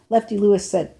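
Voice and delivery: ordinary delivery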